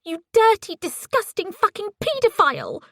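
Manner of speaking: high, loud screamed insult; screaming. A narrator's reading. Ellie screaming into the phone at Paisley, raw screaming. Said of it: "screamed into it".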